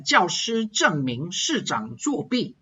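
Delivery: 教师证明市长作弊 said slowly, each fourth tone dropping firmly; forceful falling tones. Shown as a demonstration. Teacher demonstrating tones to learners, forceful falls on 教师证明市长作弊. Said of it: "fourth tone has to come down forcefully".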